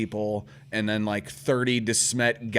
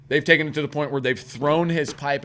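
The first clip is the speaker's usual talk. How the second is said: normal talking voice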